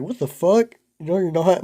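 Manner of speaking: high, loud shocked outburst from a big sister. Retold or imitated. Older sister hears his weed plan, shocked loud scolding. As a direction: snap it like a sister who won't allow it.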